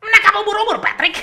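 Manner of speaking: high, loud cartoon sponge voice; hammed-up squeaky SpongeBob. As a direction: chirp it like an eager cartoon sponge. SpongeBob voice, jellyfishing.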